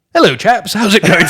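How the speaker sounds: high, hammed-up posh English accent; ridiculous English accent. British soldier fakes English to the French, ridiculous posh English accent. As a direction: overdo a posh English greeting. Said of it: "put on a ridiculous English accent".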